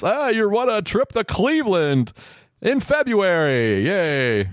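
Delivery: high, fake-bright prize announcement; sarcastic cheer. Mocking the Cleveland trip prize, fake high cheer. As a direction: sell the lousy prize with mock delight.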